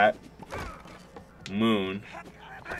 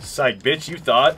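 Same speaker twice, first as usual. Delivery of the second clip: louder, higher playful gotcha; gloating taunt; 5 semitones higher, 6 dB louder, slower than usual. Real, not acted. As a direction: crow over a prank on your partner. Gloating after tricking the partner.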